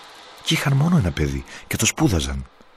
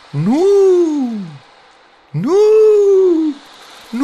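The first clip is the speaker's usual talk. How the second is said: drawn-out call out to sea; calling with all might; 9 dB louder, slower than usual. A narrator's reading. Boy calls the fish by name at the sea, loud call. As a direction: call out long across the water. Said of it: "φωνάζει με όλη του τη δύναμη".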